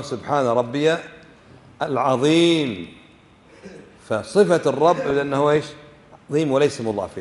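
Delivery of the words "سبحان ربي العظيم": ربي ending in -ya, pause, then العظيم drawn out; correct pronunciation. Shown as a demonstration. Teacher gives students the proper wording, careful pronunciation. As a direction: separate ربي from a long, slow العظيم. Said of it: "وإنما".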